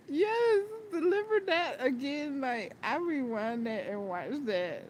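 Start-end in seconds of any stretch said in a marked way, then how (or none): 0.1-2.4 laugh-crying cheer. Real, not acted. Cracking up at favorite scene.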